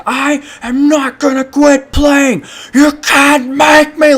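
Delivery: high, loud and slow, every word yelled; teen refusing to quit his game, screaming; screaming tantrum. Retold or imitated. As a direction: shriek it at your parents like a furious kid. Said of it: "screaming shit like that".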